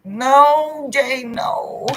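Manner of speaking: sudden high loud protest; a squealed mock protest; high emphatic no. Real, not acted. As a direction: cut her off, shocked. Emphatic refusal to a viewer.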